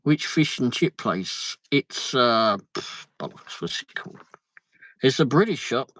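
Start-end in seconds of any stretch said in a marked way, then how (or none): 2.7-4.2 whispered mutter to self; under breath. Real, not acted. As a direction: breathe it, searching for the name. Muttering while trying to recall.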